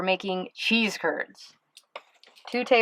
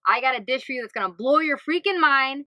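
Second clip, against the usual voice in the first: ordinary talk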